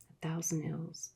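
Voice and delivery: plain reading voice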